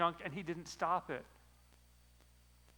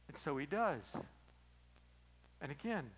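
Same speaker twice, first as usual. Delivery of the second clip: ordinary delivery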